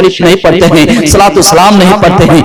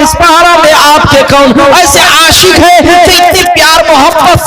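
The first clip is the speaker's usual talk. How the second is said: raised, sing-song preaching of the story; 7 semitones higher than usual. Acted. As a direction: preach the tale to the gathering.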